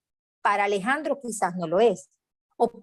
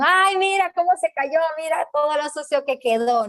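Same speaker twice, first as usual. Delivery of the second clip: higher, lightly voiced ridiculing mother; mocking mother; 6 semitones higher than usual. Mother pointing out her fallen child so others laugh, higher mocking voice. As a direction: laugh at the child in front of everyone.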